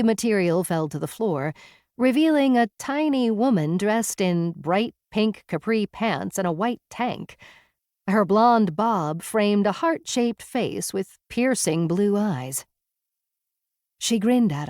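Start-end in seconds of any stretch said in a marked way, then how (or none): none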